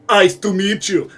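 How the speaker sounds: slow cool one-liner voice; action hero one-liner. Pretend. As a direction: deliver the pun like a movie hero. Pun line in action voice.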